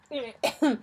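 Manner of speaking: a soft, rough cough coming to; weak cough. Acted. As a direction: cough as if just waking. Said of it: "The knight coughed".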